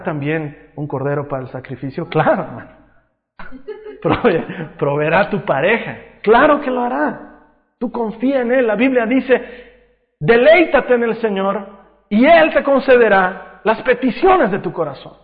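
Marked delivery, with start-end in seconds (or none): 6.2-15.2 high, loud pulpit delivery; fervent preaching. Real, not acted. Preacher urging trust by quoting the Bible, fervent preaching. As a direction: proclaim the promise with force.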